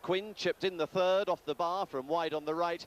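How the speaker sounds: normal talking voice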